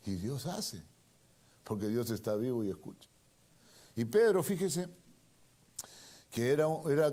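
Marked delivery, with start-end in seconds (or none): none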